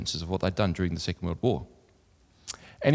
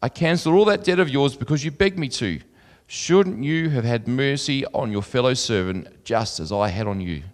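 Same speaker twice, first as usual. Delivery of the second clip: raised, carrying Bible reading voice; louder and higher than his own talk, like reading from the pulpit; scripture reading; 3 semitones higher, 4 dB louder than usual. Acted. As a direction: read the parable out to the congregation. Master rebukes in scripture.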